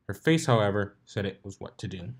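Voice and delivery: plain reading voice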